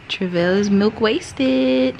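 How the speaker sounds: usual speaking voice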